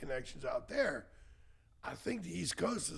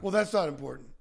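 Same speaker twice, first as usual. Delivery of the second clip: louder, curt dismissal; dismissive brush-off; 11 dB louder, faster than usual. Retold or imitated. The bank brushing off his record, dismissive. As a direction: wave him off. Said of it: "They go".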